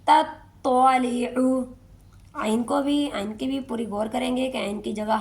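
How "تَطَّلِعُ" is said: تَطَّلِعُ slow, heavy ṭa kept distinct; tajweed pronunciation. Shown as a demonstration. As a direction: separate ta and tua clearly. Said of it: "تا اور توا کو پڑھتے وقت ہم فرق رکھیں گے". (تا اور طا میں فرق)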